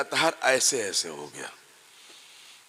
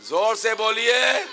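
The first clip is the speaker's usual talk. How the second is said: ordinary delivery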